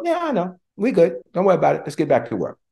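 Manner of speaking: high, breezy dismissive voice; casual brush-off. Retold or imitated. People shrugging off your confession, breezy and dismissive. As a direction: toss it off lightly like it's nothing. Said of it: "they go".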